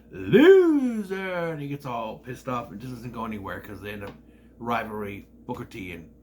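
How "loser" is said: high, loud Loser! at a rival; mock taunting shout. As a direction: jeer at Booker. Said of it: "looks at Booker and goes".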